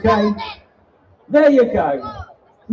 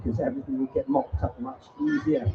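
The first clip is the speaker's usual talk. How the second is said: normal talking voice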